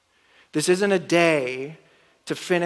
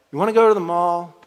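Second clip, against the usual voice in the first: louder, higher mock spouse question; bored suggestion; 4 semitones higher, 8 dB louder, faster than usual. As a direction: toss out the idea on a whim. Bored couple deciding the day.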